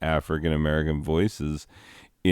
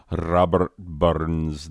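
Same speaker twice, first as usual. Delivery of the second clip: put-on Scottish accent; much slower than usual. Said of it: "the great Scottish poet".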